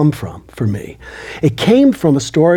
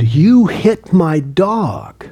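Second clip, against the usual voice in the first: slow, higher-pitched retold yell; retold scream; 7 semitones higher, much slower than usual. Yelling slowly at the driver who hit his dog, retold. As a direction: drag each word out, pitched up, reliving the scream. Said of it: "And I screamed".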